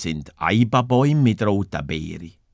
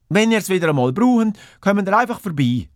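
Schwertschmied; 9 semitones higher, faster than usual. Acted. Swordsmith offers sword again, voiced.

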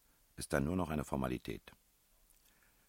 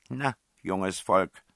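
slow, louder matchmaking aunt; well-meaning aunt; 5 semitones higher, 9 dB louder, much slower than usual. A narrator's reading. Princess eyeing the young pair, auntly. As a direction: draw it out indulgently, pitched up. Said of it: "wie eine wohlmeinende Tante".

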